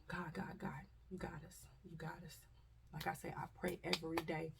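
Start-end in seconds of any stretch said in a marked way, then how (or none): 0.1-2.4 soft unvoiced pleading to God; fleeing mother whispering God's name; whispered prayer. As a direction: breathe the prayer quietly. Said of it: "That's all I could do".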